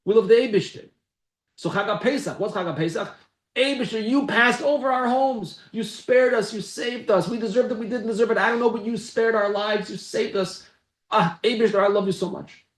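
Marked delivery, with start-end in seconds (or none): 3.6-12.7 high, pleading, loving address to God; fervent prayer to God. Acted. The Berditcher thanking God for sparing them, high and fervent. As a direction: pour your heart out thanking Him. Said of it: "That's the Berditcher. I'm paraphrasing".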